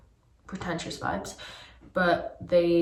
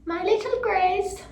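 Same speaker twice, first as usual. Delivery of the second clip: much higher, drawn-out repeat; high-pitched whine; 12 semitones higher, slower than usual. Pretend. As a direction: squeak it slowly in a high voice. Repeats it in silly voice.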